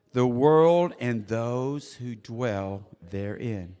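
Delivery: slow, deliberate, building in volume and pitch; slow scripture reading. Real, not acted. Preacher leads congregation reading the psalm in unison, slowly. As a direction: read it out slowly, swelling to a loud proclamation. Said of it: "I'm gonna read it slowly".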